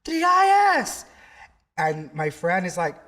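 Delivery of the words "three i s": loud high yell from across the street; calling out. Retold or imitated. Stranger spots him in Times Square, loud high call. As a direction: call his name out across the crowd. Stranger yelling his name again.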